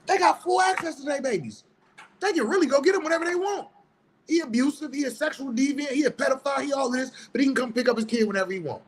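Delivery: sarcastic rant. Real, not acted. Mocking deadbeat dads' easy access.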